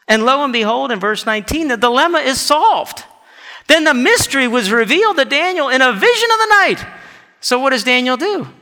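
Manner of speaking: rising, high and forceful preacher; ringing pulpit announcement; preaching. Real, not acted. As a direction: proclaim the breakthrough to the congregation.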